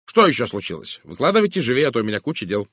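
impatient. A narrator's reading. Busy woman to the detective who called her aside, impatient. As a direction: hurry him along, no time to spare. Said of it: "нетерпеливо сказала мисс Говард".

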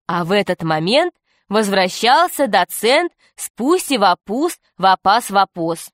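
ordinary delivery

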